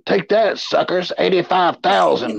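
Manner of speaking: a bit of a high, gloating taunt at everyone. Pretend. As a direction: rub it in like a playful winner. Gloating about his old pay.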